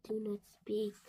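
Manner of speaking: soft, hushed whisper; dramatic whisper. Acted. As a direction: hush her gently. Gerald hushes Piggy.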